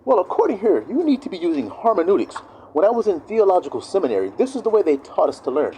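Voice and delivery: mock a seminary know-it-all; theologian. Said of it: "they start going".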